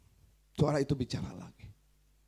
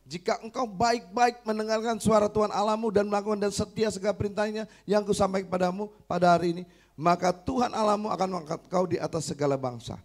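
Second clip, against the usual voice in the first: loud, higher sermon reading voice; scripture reading; 8 semitones higher, 7 dB louder than usual. Acted. Preacher reading the wife's Bible verses aloud, proclaiming loudly.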